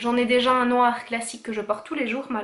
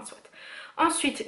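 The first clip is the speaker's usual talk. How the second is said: usual speaking voice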